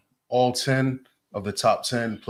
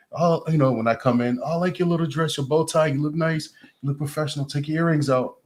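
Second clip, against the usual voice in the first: put-on French accent; 6 semitones higher than usual. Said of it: "She's an African lady with a French accent".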